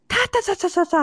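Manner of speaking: high, staccato "ta-ta-ta" sputter; comic high-pitched stutter noise; imitated stunned stammer. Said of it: "I don't know what that sound was".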